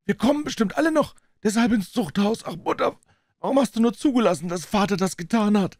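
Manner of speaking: distressed daughter crying out from the corridor; hoarse girl's voice. Acted. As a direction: cry out in despair as the young daughter. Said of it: "eine heisere Stimme von draußen".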